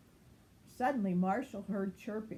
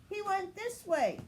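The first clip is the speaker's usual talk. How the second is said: much higher announcing pup; 11 semitones higher than usual. A narrator's reading. Chase announcing the trail, much higher. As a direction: point the way proudly. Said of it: "and announced".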